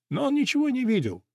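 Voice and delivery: ordinary delivery, nothing marked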